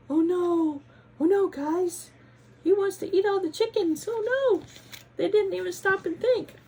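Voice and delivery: high, loud panicked cries; panicked alarm. Acted. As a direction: shout the alarm in a frightened squeal. Panicked exclamation by reader.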